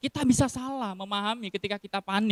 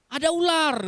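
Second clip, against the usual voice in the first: alarmed cry; 9 semitones higher, 3 dB louder than usual. Retold or imitated. Wife calls out about snake.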